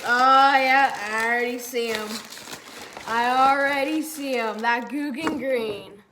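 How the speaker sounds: loud, thrilled unboxing cheer; excited and loud, savoring the find. Real, not acted. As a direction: burst out loudly on spotting the goods. Excited reaction on opening the box.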